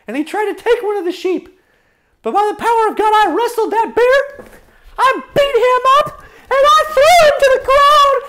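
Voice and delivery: put-on high boy voice building to excited force; high-pitched, emphatic boastful retelling; David. Shepherd boy recounting fights with beasts, high excited voice. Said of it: "David says".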